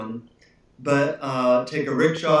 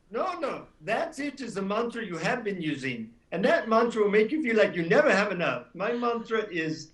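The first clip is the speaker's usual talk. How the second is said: high, softer Indian guru voice; Indian accent; 6 semitones higher, 3 dB quieter than usual. Retold or imitated. Guru correcting the disappointed traveler's mantra, Indian accent. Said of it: "in his Indian accent".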